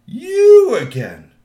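loud, high, drawn-out roar; big slow shout. Acted. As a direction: blow up at the third teen, very slow. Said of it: "he said that three times".

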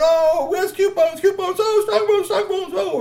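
put-on high, loud, building excited girl voice; excited troll girl. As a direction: stammer with growing recognition. Girl hugging Strongbones.

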